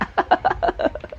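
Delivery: long breathy laugh; tawa. Real, not acted. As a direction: laugh helplessly after the apology. Laughing at own outburst. (tertawa lepas)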